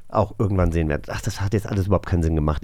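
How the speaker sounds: ordinary talk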